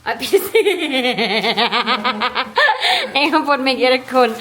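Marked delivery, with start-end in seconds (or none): 0.4-3.1 loud, long cackling laugh; big laugh. Real, not acted. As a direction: crack up at full volume. Laughing at the aircon exchange.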